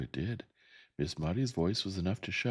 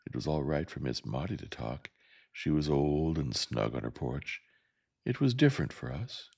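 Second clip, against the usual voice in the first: plain reading voice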